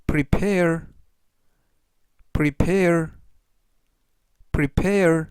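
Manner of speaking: prepare said clearly with a falling end, three times; teacher modelling prepare for learners to repeat; model pronunciation. Shown as a demonstration. Teacher drilling prepare for the class, slow and clear. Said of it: "이라고 발음하시면 되겠고요".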